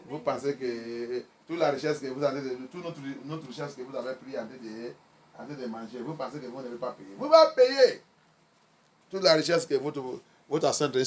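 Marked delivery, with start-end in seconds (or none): 0.1-7.1 lower, softer pulpit aside; low-key challenge. Real, not acted. Sets up rebuke before outburst. 7.2-8.0 sudden high, loud burst; raised voice, forceful preacher's threat; emphatic warning. Real, not acted.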